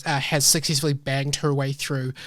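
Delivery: ordinary delivery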